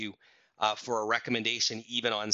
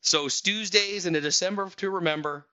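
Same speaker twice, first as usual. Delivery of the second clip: excited; 8 semitones higher, 5 dB louder than usual. Real, not acted. Host playfully repeats the pun.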